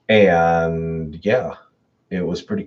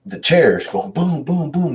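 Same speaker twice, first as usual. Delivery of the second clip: imitated banging; 9 semitones higher, 3 dB louder, slower than usual.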